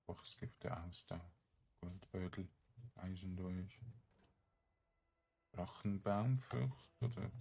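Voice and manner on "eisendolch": ordinary talk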